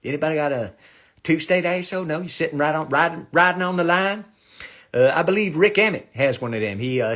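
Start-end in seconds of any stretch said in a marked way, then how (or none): none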